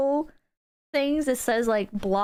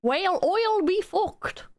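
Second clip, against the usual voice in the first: put-on Australian accent; 8 semitones higher, slower than usual. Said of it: "Dad taught me how to do an".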